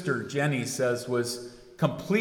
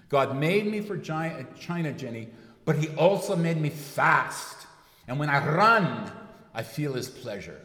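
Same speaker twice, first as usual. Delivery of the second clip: Scottish brogue; 3 semitones higher than usual. Retold or imitated. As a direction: quote the Scottish runner in a mild brogue. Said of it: "really has a good Scottish accent".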